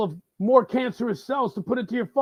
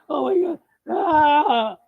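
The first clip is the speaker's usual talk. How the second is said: shrieking mock horror, much higher; hammed-up gasp of shock; mock outrage; 8 semitones higher, 6 dB louder, slower than usual. Mock outrage over Elian.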